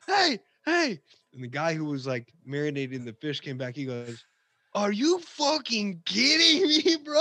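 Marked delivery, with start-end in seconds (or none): 0.1-1.0 very high, loud mock shout; calling out. Co-worker alerts the fish cook, calling out. 4.7-7.2 high, loud, slow incredulous outburst; mock fury, stretching the words; outraged yell. Cook finds his marinade ruined, outraged yell. Fish cook angry at him.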